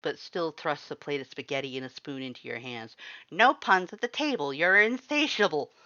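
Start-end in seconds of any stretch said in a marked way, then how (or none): 3.3-5.7 higher, louder scolding; scolding Papyrus. A narrator's reading. Papyrus scolds Sans at the table, loud and prim. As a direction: scold the punster primly.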